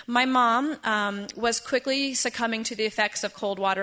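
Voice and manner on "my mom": usual speaking voice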